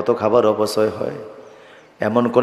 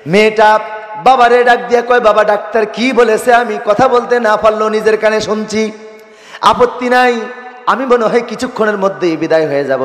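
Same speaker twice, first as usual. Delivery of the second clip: high, loud melodic preacher's chant; impassioned preaching; 10 semitones higher, 5 dB louder than usual. Acted. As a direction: preach the dying mother's plea in a raised singsong.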